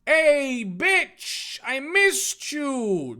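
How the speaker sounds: loud, high, stretched-out reunion cry; hammed-up overjoyed shout; excited greeting. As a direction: holler it to a long-lost friend. Dave greets long-lost friend in story.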